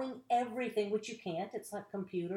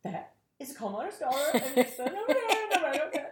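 starstruck; 6 semitones higher, 5 dB louder than usual. Retold or imitated. Starstruck staring at [name].

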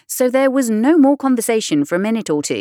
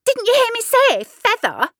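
high, louder impatient demand; angry cry; 3 dB louder than usual. Acted. Sheep scolding Alice for ignoring her warning. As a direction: scold her for not listening. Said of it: "the sheep cried angrily".

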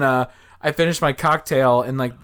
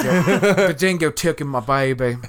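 slow, drawled imitation Aussie; mock Australian accent; 2 semitones higher than usual. Guest joins the dingo joke, mock Australian accent. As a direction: drag the vowels in a put-on Australian accent.

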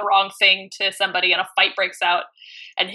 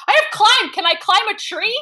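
much higher and louder, bright and eager; eager player voice; 11 semitones higher, 5 dB louder than usual. Retold or imitated. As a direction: pitch it up like a kid asking for a treat. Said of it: "he's like".

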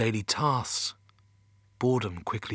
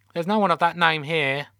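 higher, louder receptionist voice; teenage call-centre girl; 6 semitones higher, 4 dB louder than usual. A narrator's reading. Teenage girl answering Mission Control's phone, high and routine. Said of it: "who sounded in her teens".

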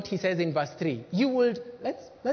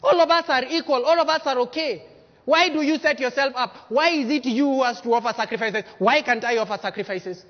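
high, loud, demanding agitator; loud rebel protest; 5 semitones higher, 5 dB louder than usual. Acted. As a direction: rouse the crowd against the leader. Voicing Dathan's rebellion, loud.

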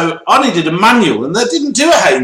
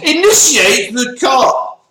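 mock shout; 7 semitones higher, slower than usual. Mocking manual phrase, shouted.

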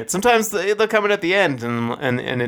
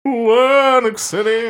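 announcer voice; 10 semitones higher, 4 dB louder, slower than usual. Acted. Announcing the next song title.

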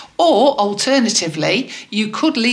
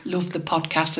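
quiet; 2 semitones lower, 8 dB quieter than usual. Shown as a demonstration. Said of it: "you must finish it with".

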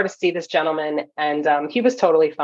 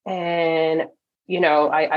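usual speaking voice

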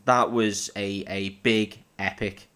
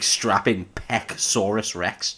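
slow, savoured mock title; slow hammed-up nickname; mock grand title; much slower than usual. Real, not acted. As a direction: drag out the silly name for the joke. Joking about a muscly actor.

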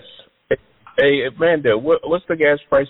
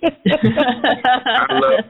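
high, very slow drawn-out craving; hammed-up pleading; 12 semitones higher than usual. Friend mock-pleading his love of fried chicken, high and slow. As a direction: stretch every word like a cartoon lover of fried chicken.

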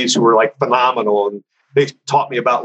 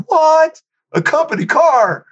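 high-pitched disbelieving delight; acted-out amazement at an unexpected perk; astonished; 14 semitones higher, slower than usual. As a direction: blurt it out, voice leaping up. Retold astonishment at job perk.

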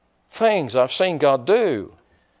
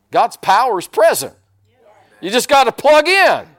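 louder and higher, rising to a shout; building preacher's cry; preaching climax; 9 semitones higher, 6 dB louder than usual. Real, not acted. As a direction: drive the point home to the congregation.

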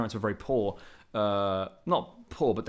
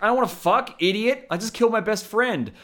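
higher, louder exasperated outburst; a loud, pitched-up grieving snap; mock angry Spock; 11 semitones higher, 6 dB louder than usual. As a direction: blurt it at her, hammed-up fury. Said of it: "And he's like".

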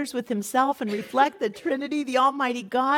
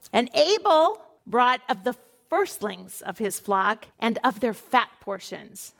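normal talking voice

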